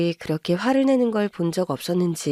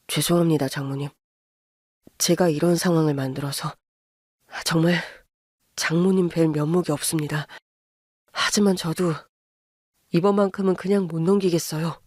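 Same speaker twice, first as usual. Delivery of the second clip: put-on lower, restrained young man apologizing; son-in-law apologizing to his wife's mother, lower and restrained; apologetic son-in-law; 5 semitones lower than usual. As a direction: hold it down, sorry but firm. Narrator voices apologetic son-in-law.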